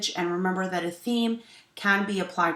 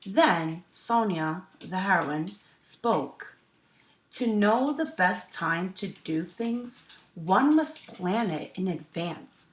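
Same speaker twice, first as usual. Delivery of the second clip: story narration; 2 semitones higher, 7 dB louder, slower than usual. A narrator's reading. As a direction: clear storytelling voice for children. Teacher reads picture book aloud.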